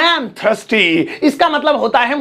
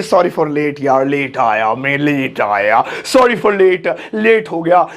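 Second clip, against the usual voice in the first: imitating a late apology; 8 semitones lower, slower than usual. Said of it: "बहुत सारे लोग बोलते हैं".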